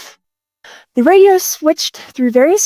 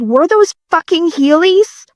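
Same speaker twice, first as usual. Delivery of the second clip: usual speaking voice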